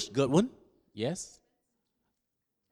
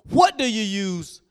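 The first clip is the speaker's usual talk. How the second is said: ordinary talk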